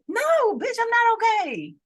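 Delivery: high, loud indignant cry; hammed-up, exasperated outburst; outraged retort. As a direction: wail it in mock outrage, pitch way up. Acted outraged reply to are you okay.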